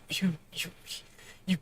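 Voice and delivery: breath gone, only a whisper left; throat closing, words barely voiced; tearful broken whisper; choked whisper. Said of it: "The air is stiffening in my throat".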